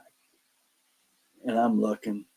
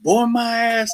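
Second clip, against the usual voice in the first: defiant retort; 12 semitones higher, 4 dB louder, slower than usual. Retold or imitated. Defiantly answering the ghost voice.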